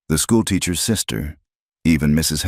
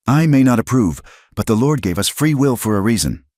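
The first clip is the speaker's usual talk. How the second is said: speaking up; 7 semitones higher, 4 dB louder than usual. A narrator's reading. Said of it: "The minister's wife spoke up now".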